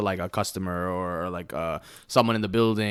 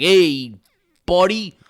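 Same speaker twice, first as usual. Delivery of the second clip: loud, higher mock Italian greeting; heavy Italian accent; 8 semitones higher, 9 dB louder than usual. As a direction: call out like a hammed-up Italian New Yorker. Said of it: "heavy Italian accent".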